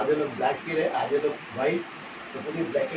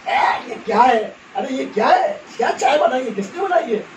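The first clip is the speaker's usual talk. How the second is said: louder and higher, indignant about the tea; loud complaining; 6 semitones higher, 5 dB louder than usual. Retold or imitated. Guests at the ladies' gathering, loud indignant complaint. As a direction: demand who made this awful tea.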